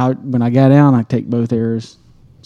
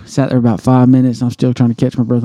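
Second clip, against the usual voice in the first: usual speaking voice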